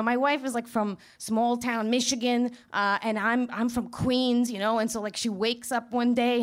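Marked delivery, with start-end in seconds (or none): none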